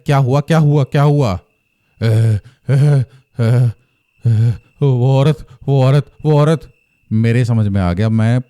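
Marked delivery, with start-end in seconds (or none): 0.1-1.3 urgent, higher-pitched repeated questioning; acted panic, words tumbling in repetition; alarmed. Finds wife shaking on the floor. 2.0-6.7 gasped, broken syllables before the words; imitating wife's panicked stutter; terrified stammer. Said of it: "भयंकर तरीके से काँप रही है".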